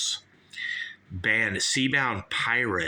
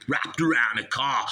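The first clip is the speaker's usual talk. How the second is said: higher-pitched mock Aussie; Australian accent; 4 semitones higher than usual. Snake handler describes the boa, mock Australian accent. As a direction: play it as a broad Australian. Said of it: "Oh, I like Australian".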